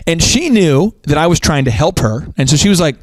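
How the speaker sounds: ordinary delivery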